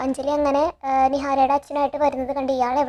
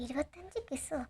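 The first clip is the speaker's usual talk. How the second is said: much softer, hushed thinking aloud; inner thought; 13 dB quieter than usual. Retold or imitated. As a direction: murmur it to yourself in disbelief. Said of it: "Gautam ഇങ്ങനെ ആലോചിക്കുകയാണ്". (സ്വയം ആലോചിക്കുന്ന പതിഞ്ഞ ശബ്ദം)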